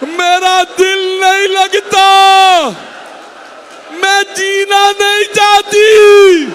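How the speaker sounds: high, loud, slow wailing; crying lament. A narrator's reading. Mother cries to her slain son, high slow loud wailing. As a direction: wail it out, stretched and high as a keening mother. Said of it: "रो रो के कहने लगी".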